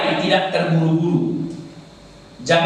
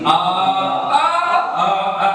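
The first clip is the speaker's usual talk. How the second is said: stepped pitch demo; 2 semitones higher than usual. Shown as a demonstration. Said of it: "naik sedikit".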